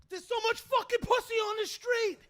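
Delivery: loud, high-pitched outburst; worked-up exclamation. Retold or imitated. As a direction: exclaim it way up high, full of amazement. Exclaims why his neck hurt.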